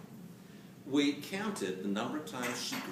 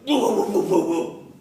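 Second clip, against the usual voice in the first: loud, higher, laughing whoa whoa; bitch's warning whoa; 7 semitones higher, 14 dB louder than usual. Retold or imitated. Female dog in heat loudly warning off a male. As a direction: play the female dog fending him off, big and loud. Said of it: "and she goes".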